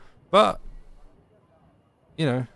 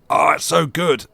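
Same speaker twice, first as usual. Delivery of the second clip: higher, louder burst of mock enthusiasm; mock gushing; 5 semitones higher, 8 dB louder than usual. Streamer mimicking everyone who loves the song, mock gushing. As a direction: gush loudly like an overexcited fan. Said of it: "that everyone's like".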